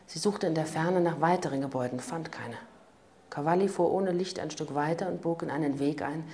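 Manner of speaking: plain reading voice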